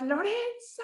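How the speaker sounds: high-pitched mock shout, held back in volume; drawn-out, high mock yell of a name; imitated teacher's yell. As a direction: call out like an exasperated teacher, without real loudness. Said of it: "me empezó a gritar".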